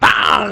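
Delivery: sharp loud mock clang of a heel kick; shouted clang sound effect. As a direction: bang it out like a metal strike.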